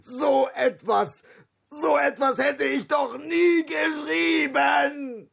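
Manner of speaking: outraged lion shouting he never wrote it; lion roaring. A narrator's reading. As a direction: bellow it as the lion. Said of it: "brüllte der Löwe".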